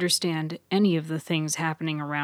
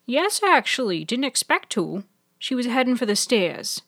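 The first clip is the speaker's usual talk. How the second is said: higher-pitched chatty nurse; nurse's voice; 6 semitones higher, 3 dB louder than usual. A narrator's reading. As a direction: answer as a helpful nurse, pitched up. Narrator voices Nurse Hazel.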